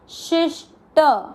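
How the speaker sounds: शिष्ट slowly, half ष joined to शि; slow syllables. Shown as a demonstration. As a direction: draw out शिष्ट clearly. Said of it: "वहीं से शी के साथ आधा श". (शिष्ट धीरे-धीरे साफ़ उच्चारण)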